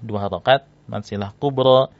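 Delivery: normal talking voice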